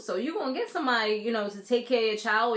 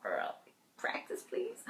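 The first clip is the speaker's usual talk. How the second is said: much lower, much softer, breathy and half-laughing; laughing aside; 13 semitones lower, 12 dB quieter, slower than usual. Retold or imitated. Vlogger teasing the actress about her accent, soft laughing aside. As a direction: laugh it out under your breath. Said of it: "I'm just like".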